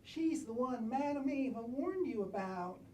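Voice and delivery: normal talking voice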